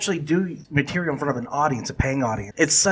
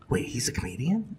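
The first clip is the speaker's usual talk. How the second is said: whispered surprise; 2 semitones lower, 8 dB quieter than usual. Retold or imitated. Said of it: "I remember just being like".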